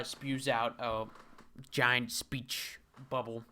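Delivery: ordinary talk, nothing marked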